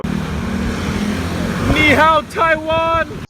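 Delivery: loud, high-pitched yell out the window; long, drawn-out excited shout. Retold or imitated. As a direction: holler it at full volume to a whole street. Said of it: "we kept yelling out the window".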